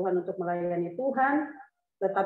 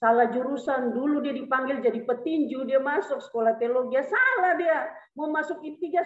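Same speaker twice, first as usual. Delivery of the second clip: ordinary delivery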